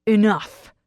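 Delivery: short, loud roared command. A narrator's reading. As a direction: roar to cut them off. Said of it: "he roars".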